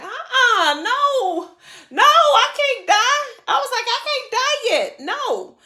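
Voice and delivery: high, frantic, half laughing; begging not to die; pleading. Retold or imitated.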